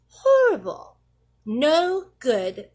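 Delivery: ordinary delivery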